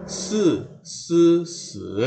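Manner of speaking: se said in rising then falling pitches; four tones on se. Shown as a demonstration. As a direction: se sung in contrasting tones.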